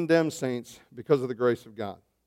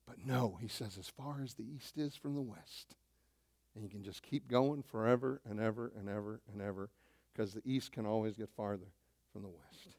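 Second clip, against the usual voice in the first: much softer, lower, almost murmured; quiet reverent wonder; hushed awe; 5 semitones lower, 16 dB quieter than usual. Acted. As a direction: draw them in with a hush. Sudden hush in sermon.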